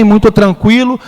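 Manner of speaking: ordinary delivery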